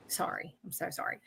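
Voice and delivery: breathless whisper, choked up; whispered apology. Real, not acted. Medium apologizes to the sitter in a choked whisper. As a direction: barely get the words out. Medium overwhelmed mid-reading.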